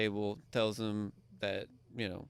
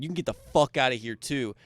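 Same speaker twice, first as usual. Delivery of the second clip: loud, firm throw-him-out order; angry command; 2 semitones higher, 8 dB louder than usual. Retold or imitated. Worker throws rude player out of casino, loud and angry. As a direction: tell him to leave, furious. Kicking the man out.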